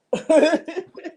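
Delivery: bright rising laugh. Real, not acted. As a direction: laugh it off when told time is short. Laughing at the time warning.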